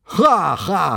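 mocking laughter. A narrator's reading. Soldiers laughing at Fishlegs.

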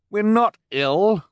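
high, loud protesting cry. A narrator's reading. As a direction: shout the denial back. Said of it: "Klaus cried".

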